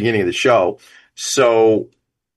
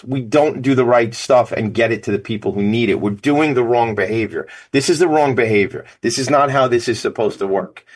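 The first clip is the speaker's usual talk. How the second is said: normal talking voice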